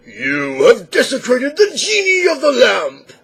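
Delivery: a hammed-up furious genie roar; angry genie. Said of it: "the genie loses temper and says".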